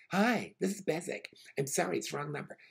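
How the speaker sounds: stilted phone-company announcement; automated message in English. Retold or imitated. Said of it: "the exact same guy gives the exact same message in English".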